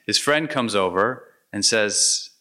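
ordinary delivery

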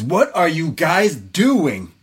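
imitated exasperated yell, slow; mock yelling. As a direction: stretch out the scolding question, without real volume. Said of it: "She's yelling at".

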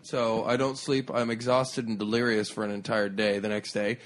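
ordinary delivery

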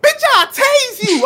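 high, loud, slow mock threat; threatening yell. The other lady threatening mom, imitated loud threat. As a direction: threaten like a furious stranger. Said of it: "the other lady was like".

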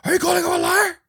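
very high, loud outburst; mock angry shout. Billy confronting Owen over his wife, shouting. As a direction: bellow the accusation in mock outrage.

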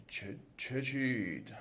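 slow, soft, drowsy question; half-asleep demon. A narrator's reading. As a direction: mumble it slowly, half awake. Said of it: "خواب و بیدار گفت".

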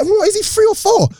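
very high disbelieving question; amazed high voice. Retold or imitated. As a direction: squeak it in shock. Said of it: "You're like".

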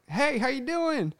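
slow, high sing-song cashier greeting; lightly voiced cheerful cashier; imitating the cashier. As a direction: mimic the friendly drive-thru lady. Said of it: "she's like".

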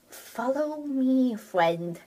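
friendly cat character. Acted. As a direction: coax gently and slowly, like a simple creature. Voicing Scribbles leading the child.

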